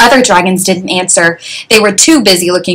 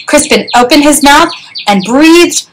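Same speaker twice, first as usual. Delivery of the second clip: ordinary delivery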